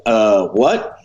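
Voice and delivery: drawn-out, puzzled what, rising at the end; taken aback, tentative double take; baffled. Retold or imitated. Friend baffled by his announcement.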